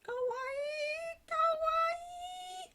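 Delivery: very high, loud kawaii squeal; cute squeal. Pretend. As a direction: squeal it like a cute anime fan. Squeals over cute Santa eyes.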